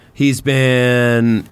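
usual speaking voice

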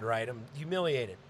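ordinary talk

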